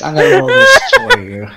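high, loud, laughing through the words. Real, not acted. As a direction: crack up while you talk. Cracking up over the joke.

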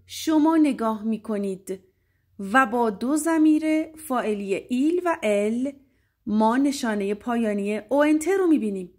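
usual speaking voice

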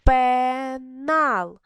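ПЕНАЛ drawn out as a long ПЕ, then НАЛ; slow, separated beats on Пенал; split into syllables. Shown as a demonstration. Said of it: "Поділіть на склади".

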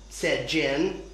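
ordinary delivery

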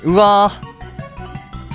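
usual speaking voice

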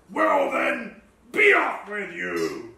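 loud troll dismissal; gruff troll. A narrator's reading. Troll lets the little goat go, gruffly. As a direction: send them off like a troll. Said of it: "roared the troll".

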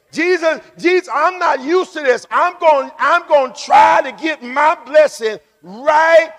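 high, driving sermon delivery; full-force preaching. Real, not acted. Preacher urging the congregation to praise God, full-force preaching.

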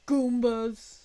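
loud hammy Goombas shout; loud Mario shout. Pretend. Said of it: "I hope my neighbor can hear me".

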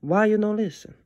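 high Italian grandma scold. Acted. Grandma scolding the boy who missed the bus.

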